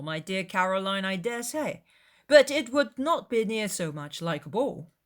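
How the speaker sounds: playful brotherly teasing; teasing Charles Bingley. A narrator's reading. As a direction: throw her words back at her with a grin. Charles teases his sister.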